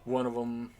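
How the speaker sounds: usual speaking voice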